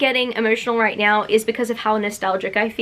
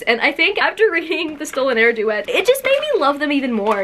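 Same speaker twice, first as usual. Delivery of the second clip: ordinary talk, nothing marked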